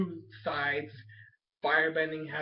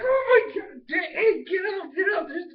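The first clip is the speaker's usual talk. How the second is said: much higher, louder frantic crying shout; panicked shouting; 14 semitones higher, 7 dB louder than usual. Retold or imitated. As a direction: wake everyone in terror. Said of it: "He's like".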